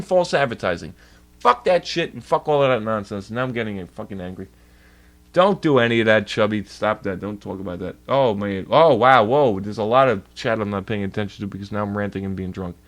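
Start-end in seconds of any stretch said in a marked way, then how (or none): none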